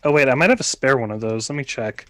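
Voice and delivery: ordinary delivery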